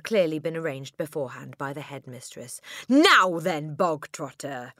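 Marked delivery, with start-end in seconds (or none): 2.9-4.7 booming. A narrator's reading. Headmistress turning on the boy, booming. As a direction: boom it at him to begin. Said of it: "the Trunchbull boomed".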